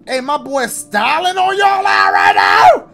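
yelling to chat about his hat; hype shout. Real, not acted. Hyping his hat style.

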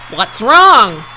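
loud announcer's query; loudspeaker question. A narrator's reading. Loudspeaker sees the pig collapse, loud announcer's question. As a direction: call it out over the fairground. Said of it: "asked the loudspeaker".

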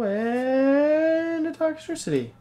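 long, high climbing "and" before the name; slow, high build to the reveal; drawn-out card reveal. Real, not acted. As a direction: hold the lead-in high, slowly.

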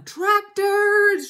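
loud, high shout of alarm. A narrator's reading. Mister Fox warning his family, urgent shout. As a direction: shout the warning at full force. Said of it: "shouted Mister Fox".

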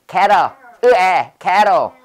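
캐러 with 으 gliding open into 애; 으-to-애 vowel demo. Shown as a demonstration. Teacher drilling the class on one vowel, emphatic pronunciation demo. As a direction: show the glide alone, then in 캐러. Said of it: "의에서 해".

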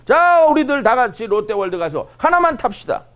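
high, loud, drawn-out summons; rallying call. Pretend. Leader calling the group to an amusement park, loud slow rallying cry. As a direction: round everyone up for an outing.

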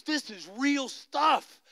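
high, slow, stretched-out wonder; imitated amazement. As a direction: retell his astonishment, drawing out the words. Healed blind youth's amazed words retold.

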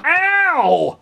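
high, loud "ow"; yelp of pain. Acted. Boy yelps as his brother punches him, high and loud. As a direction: cry out as if punched.

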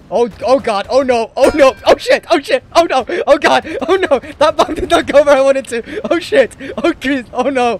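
terrified gibbering. Real, not acted. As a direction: babble in real panic as the bomb misses. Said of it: "That was fucking terrifying".

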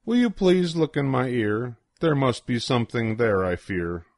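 ordinary delivery, nothing marked

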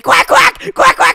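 very high loud quacking; mock duck quacks. Quacking at school crush.

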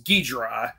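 Ghidra squeezed to two syllables, like GEE-dra; fully run-together Ghidra; contracted pronunciation. Shown as a demonstration. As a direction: swallow the middle vowel of Ghidra completely. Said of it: "syllables and consonants sometimes get swallowed up".

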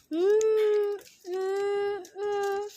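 high little whimpering hums; crying hums. Acted. Bird crying to elephant.

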